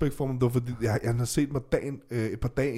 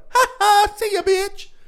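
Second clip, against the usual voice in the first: mocking taunt; 8 dB louder, slower than usual. Taunting the detained teacher.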